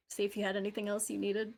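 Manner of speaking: ordinary delivery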